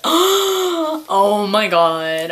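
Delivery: slow, loud, breathy awe; awed gasp. Retold or imitated. Answering the cashier, slow awed exclamation. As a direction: gasp it out in disbelief.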